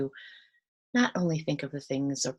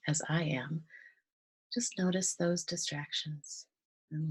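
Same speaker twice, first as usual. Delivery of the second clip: soft, calm meditation voice with long pauses; guided meditation; 4 dB quieter than usual. Real, not acted. Guide leading listeners through breathing and gratitude, softly meditative. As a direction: gently guide them into relaxation, leaving space between phrases.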